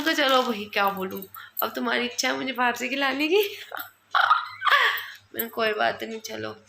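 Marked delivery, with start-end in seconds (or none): 4.1-5.1 short high-pitched giggle. Real, not acted. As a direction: giggle at the husband's treat. Laughing at husband's insistence. (पति की बात पर हल्की खिलखिलाहट)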